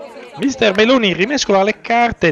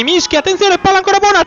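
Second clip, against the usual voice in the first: excited near-miss call; 7 semitones higher, 4 dB louder than usual. Real, not acted. Near miss, live commentary.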